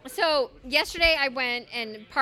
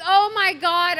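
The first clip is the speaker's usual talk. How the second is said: loud, higher outburst; exasperated; 6 semitones higher, 8 dB louder, slower than usual. Retold or imitated. Woman retells her reply to partner's excuse, exasperated. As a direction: burst out in retold disbelief.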